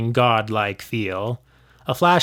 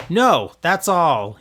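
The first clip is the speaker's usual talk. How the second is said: crying out; 4 semitones higher, much slower than usual. A narrator's reading. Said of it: "he cried".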